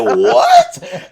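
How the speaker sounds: very high, loud laughing squeak; squeaky laughing what; shocked what. Retold or imitated. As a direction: squeal it in disbelief. Shocked reaction retold.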